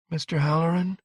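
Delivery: high, feeble querulous question; weak, querulous. A narrator's reading. Injured woman calls for Halloran, weak and querulous. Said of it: "Her voice was low, weak, querulous".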